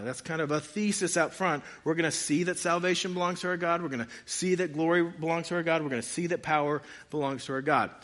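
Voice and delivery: usual speaking voice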